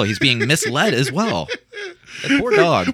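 high, laughing sympathy for the dog; laughing outrage. Real, not acted. Co-host defending the misled dog, laughing outrage. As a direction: protest through rising laughter.

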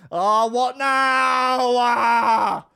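loud high groan ending in a yell; exasperated shout. Acted. Dreading the news, a loud high exasperated yell. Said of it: "you're like".

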